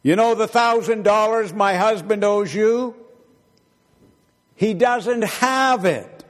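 high, loud acted holler; woman yelling. Wife yelling to the neighbor about her husband's debt. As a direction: yell it across to the next house. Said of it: "the woman yelled".

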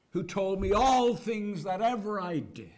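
loud, high cry of wonder. Retold or imitated. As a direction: call it out to the whole town. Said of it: "she cries out to all of them".

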